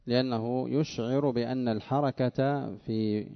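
ordinary delivery

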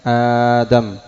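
آدم with long smooth first aa; alif replacing hamza. Shown as a demonstration. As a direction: stretch آدم at the start. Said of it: "إبدال الألف".

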